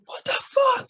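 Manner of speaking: very high squealed imitation of exasperation; comic falsetto protest; mock outraged disbelief. Teammates' exasperated reaction retold.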